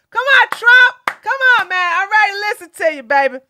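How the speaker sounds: high, eager calling out; excited cheering. Real, not acted. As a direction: rush the words, hyped up.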